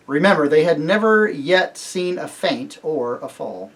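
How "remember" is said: plain reading voice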